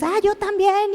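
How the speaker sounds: mock enthusiastic volunteer; mimicked eagerness. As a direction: pipe up like an eager copycat.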